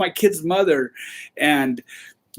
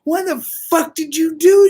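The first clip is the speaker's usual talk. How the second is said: a higher, louder outraged shout; angry boss yell; 9 semitones higher, 4 dB louder than usual. Retold or imitated. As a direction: yell at the kid in disbelief. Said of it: "He's like".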